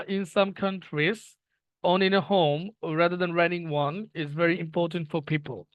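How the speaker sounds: slow, careful reading of an English sentence; slow read-aloud. Shown as a demonstration. Teacher reading the exam question to students, slow and careful. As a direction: read each word out slowly.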